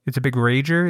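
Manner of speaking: usual speaking voice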